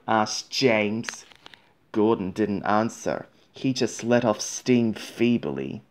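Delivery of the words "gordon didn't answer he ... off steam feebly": usual speaking voice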